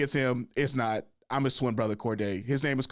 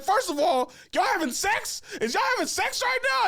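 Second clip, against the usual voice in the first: much higher, incredulous retold reply; mock disbelief. Telling his brother about the students, high mock disbelief. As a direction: climb higher with each shocked question. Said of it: "I'm like".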